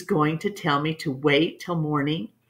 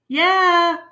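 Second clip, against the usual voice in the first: answering call, much higher and louder; calling back; 13 semitones higher, 6 dB louder than usual. A narrator's reading. Boy answering his father from out of sight, loud call. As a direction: shout back from behind the bushes.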